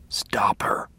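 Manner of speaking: weak but deliberate whispered order; hoarse whisper. A narrator's reading. Father finally gives his son permission, hoarse whisper. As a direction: whisper it as a firm command.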